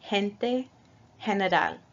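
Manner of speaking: gente and general with the G spoken as a breathy H; soft G like H. Shown as a demonstration. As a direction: say gente as hente. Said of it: "pronounced like an H in English".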